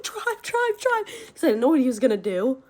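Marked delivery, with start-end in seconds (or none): 0.0-1.0 high, urgent retold plea; mock panic. Telling friends to flee stepdad by car, mock panic. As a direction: squeal it as an imitated panicked order.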